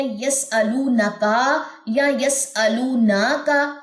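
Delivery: very slow یسألونکا یا یسألوناکا with vowels stretched; wrong readings. Shown as a demonstration. Teacher mimicking students' wrong یسألوناکا. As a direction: drag the nun vowel in یسألوناکا. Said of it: "یہاں پہ کیا mistake ہوتی ہے".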